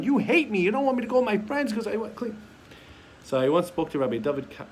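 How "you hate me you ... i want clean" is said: louder, higher accusing cry; child shouting back at the parent; angry kid. Retold or imitated. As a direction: hurl it like a sulking teen. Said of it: "That's the line that they use".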